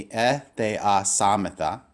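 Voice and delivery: "e, the, a, samata" in measured pieces; syllable by syllable. Shown as a demonstration. Teacher asks class to say with me, separated syllables. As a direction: lead a repeat of "e, the, a, samata", piece by piece. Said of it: "Say with me".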